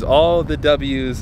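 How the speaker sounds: "all the W's" slowly stretched out; savoured repeat. Real, not acted. Owner savouring the win, slow "all the W's". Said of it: "repeated slower".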